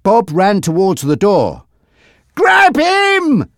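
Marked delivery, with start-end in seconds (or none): 2.4-3.5 sharp urgent shout, much higher. Acted. Frankie to Monty as Bob runs, shouting. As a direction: bark the order at full voice. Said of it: "Frankie shouted to Monty".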